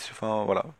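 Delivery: usual speaking voice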